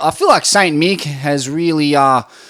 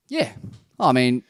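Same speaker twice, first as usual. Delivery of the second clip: ordinary delivery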